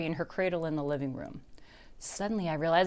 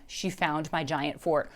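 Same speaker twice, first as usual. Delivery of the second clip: usual speaking voice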